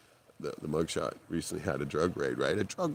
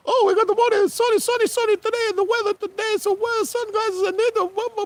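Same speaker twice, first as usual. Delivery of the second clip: a much higher, louder goofy morning-DJ voice; hammed-up radio bit; 8 dB louder than usual. Radio host riffs on the song as a goofy morning-show bit, much higher and louder. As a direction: ham up a sunny weather report. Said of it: "he did a little bit".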